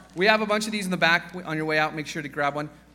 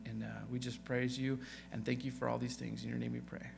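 softer, hushed voice for a congregational prayer; 3 semitones lower, 7 dB quieter than usual. Acted. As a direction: pray gently and humbly for the congregation.

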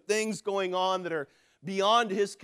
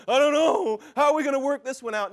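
hammed-up panic, higher pitched; mock frantic; 3 semitones higher, 4 dB louder, faster than usual. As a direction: act out a comic, flustered fret. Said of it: "God's not in heaven frantic".

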